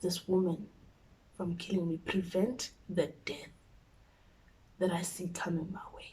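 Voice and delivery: soft, pleading prayer under the breath; hushed prayer. Retold or imitated. Praying alone before mom arrives, hushed and pleading. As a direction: pray quietly so mom won't hear.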